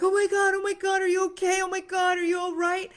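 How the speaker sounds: high, fast, overwrought Oh my God; mock panic. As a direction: play the hovering, anxious parent. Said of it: "But I'm like".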